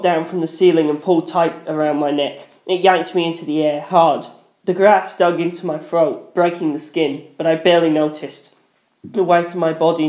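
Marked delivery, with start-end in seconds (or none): none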